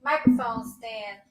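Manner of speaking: ordinary delivery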